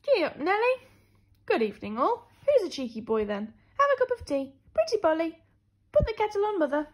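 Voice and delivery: high, loud squawky chatter; parrot voices. Acted. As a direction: play a parrot squawking phrases. Zoo animals chattering at night.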